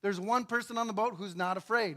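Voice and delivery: ordinary talk, nothing marked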